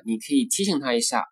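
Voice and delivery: ordinary delivery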